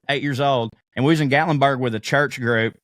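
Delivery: usual speaking voice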